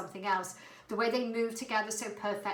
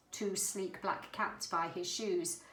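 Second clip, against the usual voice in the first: plain reading voice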